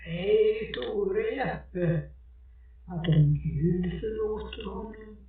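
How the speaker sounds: an eerie presence answering from the water, slowly and deliberately; ghostly lake figure. Acted. The figure in the lake answers the maid, slow and eerie.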